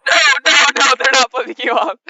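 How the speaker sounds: loud, fast laughing no; laughing denial. Real, not acted. Game master laughing while correcting a player, loud and fast.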